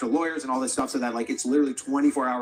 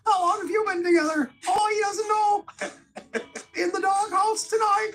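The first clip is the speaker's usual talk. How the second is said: very high, louder mocking host voice; Jordan Peterson crowd work; 3 dB louder than usual. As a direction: camp it up like a cheesy rally host working the crowd. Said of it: "Jordan Peterson, like".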